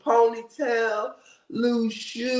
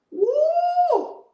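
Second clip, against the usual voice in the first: high whooping cheer; excited woo; 3 dB louder than usual. Real, not acted. Happy about church tomorrow, high woo. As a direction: let out a joyful whoop. Celebrating going back to church.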